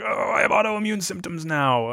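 high, drawn-out groaning complaint; ailing Gnome King. Acted. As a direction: moan slowly like a sickly villain. Gnome King groans about symptoms.

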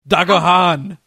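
loud foreign war cry; shouted battle command. Acted. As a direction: shout it out like an attack order. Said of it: "And then I shout".

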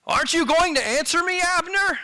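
projected, high-pitched taunting question; calling down. Acted. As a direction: call out to someone far below, pitched high. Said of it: "he calls down to Abner".

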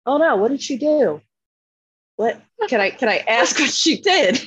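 high-pitched imitation of feigned dismay; mock-concerned granddad. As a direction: act innocently concerned, pitched well up. Voices granddad hiding his amusement.

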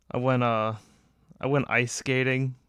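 normal talking voice

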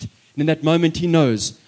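ordinary talk, nothing marked

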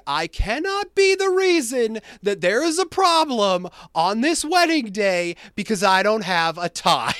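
high, loud retold panic; freaking out. Guest without a tie on the wedding day, comically freaking out. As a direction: wail it in hammed-up panic, ending in laughter. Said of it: "I was freaking out".

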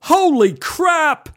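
high, loud, drawn-out scream; shocked high-pitched scream. Retold or imitated. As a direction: scream it out in horror. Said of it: "and then I screamed".

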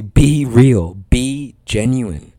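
"be real" loud and drawn out, "genuine" stretched slow; emphatic exhortation. Real, not acted. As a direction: drive home "be real", then "genuine". Preacher repeats exhortation with force.

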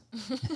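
short burst of real laughter; embarrassed giggle. Real, not acted. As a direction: laugh it off high. Laughing at own confession.

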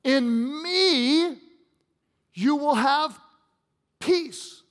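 triumphant preaching of "In me, you will have peace"; proclaimed "In me". Shown as a demonstration. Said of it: "But he says".

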